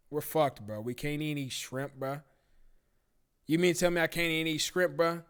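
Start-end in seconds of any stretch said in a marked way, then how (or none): none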